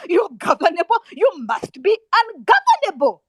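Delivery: loud, high, forceful exhortation; impassioned demand. Real, not acted. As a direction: cry it out as an angry command. Angry exhortation to students.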